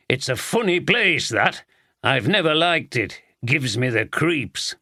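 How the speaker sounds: lightly voiced gruff Yorkshire superintendent; a hint of a blunt Yorkshire accent. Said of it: "the gruff Yorkshire voice".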